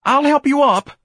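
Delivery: loud, very high panicked offer; terrorized tenor. A narrator's reading. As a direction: offer help in a frightened high voice. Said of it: "cried in his terrorized tenor".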